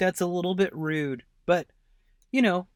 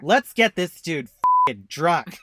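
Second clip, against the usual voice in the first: loud, slow rowdy guest voice; egging everyone on, loud; rowdy party guest; 3 semitones higher, 8 dB louder, slower than usual. Pretend.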